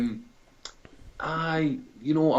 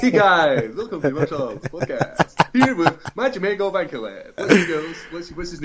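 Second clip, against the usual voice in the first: higher-pitched mock podcast host; hammed-up presenter voice, pitched up; mocking host impression; 7 semitones higher than usual. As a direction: take the piss out of the host's intro. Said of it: "Let's hear your pronunciation".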